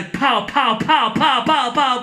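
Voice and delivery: imitated gunfire. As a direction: fire off pows like a pistol. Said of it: "shoots the bad guy".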